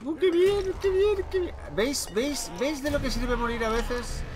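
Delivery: very high cartoonish squeal; squeaky high voice. Squeals over the Bugatti.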